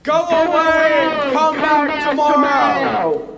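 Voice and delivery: loud, slow roared order; booming shout. Acted. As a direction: roar them away. Wizard dismisses them.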